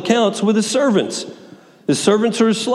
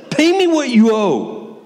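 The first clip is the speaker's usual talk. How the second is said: aggressive demand; 6 semitones higher, slower than usual. Acted. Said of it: "He's being aggressive".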